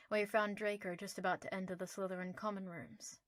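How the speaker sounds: ordinary delivery, nothing marked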